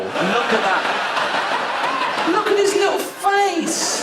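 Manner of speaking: put-on cooing over a baby. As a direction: squeal over the adorable little face. Cooing over baby Hitler photo.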